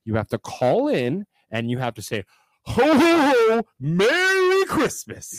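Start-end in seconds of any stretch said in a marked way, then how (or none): 2.7-5.4 high, slow jolly ho ho ho; Santa voice. Shown as a demonstration. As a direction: boom it out like Santa Claus. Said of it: "You better sound like frickin' Santa Claus".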